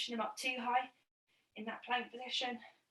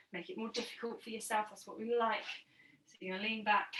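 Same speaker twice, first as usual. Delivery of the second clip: normal talking voice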